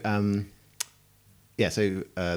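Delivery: ordinary delivery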